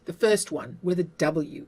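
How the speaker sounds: ordinary talk, nothing marked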